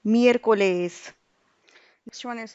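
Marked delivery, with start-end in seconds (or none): 0.1-1.1 Miércoles slow, miér hit louder; stress on miér. Shown as a demonstration. Teacher demonstrating the accent on Miércoles, slow stress. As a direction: punch miér in Miércoles, slowly. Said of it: "So we'll have to stress it out".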